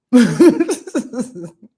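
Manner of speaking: sudden loud burst of laughter; a loud laugh through the tears. Real, not acted. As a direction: laugh out at yourself. Laughs at her own tears.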